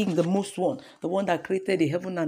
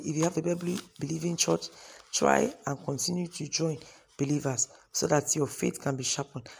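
normal talking voice

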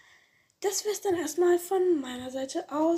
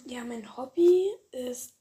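ordinary delivery

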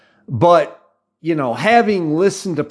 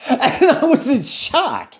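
amused laughing; 10 semitones higher, slower than usual. Real, not acted. Laughs recalling his own shock.

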